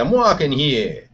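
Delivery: put-on New York accent. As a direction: drag out the New York complaint. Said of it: "New York accent".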